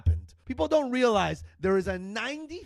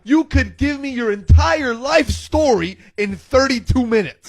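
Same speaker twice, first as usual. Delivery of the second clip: ordinary delivery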